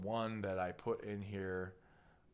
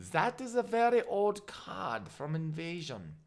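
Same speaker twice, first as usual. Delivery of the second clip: higher-pitched mock German accent; 6 semitones higher than usual. Presenter showing viewers an old card, in a mock German accent. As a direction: put on an old war-film German voice. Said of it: "I don't know why I'm doing a nineteen forty-two German accent".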